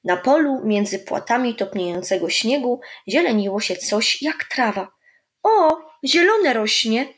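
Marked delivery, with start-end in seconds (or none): 5.4-7.1 higher, slow excited call. A narrator's reading. Olek calls to grandpa about the green shoots, excited. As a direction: point it out eagerly. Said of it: "zawołał Olek".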